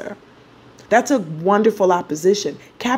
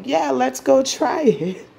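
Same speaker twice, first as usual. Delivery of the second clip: higher, slower, mock eager Venus; 7 semitones higher than usual. Venus in Sagittarius urges the moon to try the candy, higher and eager. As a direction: sell the adventurous Sagittarius Venus, pitched up. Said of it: "Venus in Sagittarius is like".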